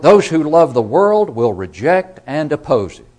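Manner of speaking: loud, high, impassioned pulpit delivery; preaching at full force. Acted. Preacher warning his congregation of eternal ruin, full-force preaching.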